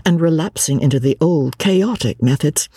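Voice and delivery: ordinary delivery, nothing marked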